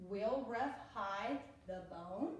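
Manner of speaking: slow, with the voice going up on bone; slow read with a questioning rise; question tune. Shown as a demonstration. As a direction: read it as a question, rising at the end. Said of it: "your voice tends to go up when you ask a question".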